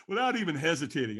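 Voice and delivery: ordinary delivery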